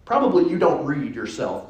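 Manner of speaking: ordinary talk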